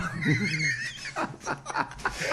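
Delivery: high wheezing laugh; real high-pitched cackle; helpless laughter. Real, not acted. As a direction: burst out laughing, out of breath. Cracks up at the prank.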